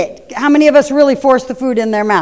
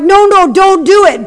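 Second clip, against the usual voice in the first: high, louder "no, no"; panicked warning to a child; alarmed parent; 9 semitones higher, 5 dB louder than usual. Retold or imitated. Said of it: "And we're like".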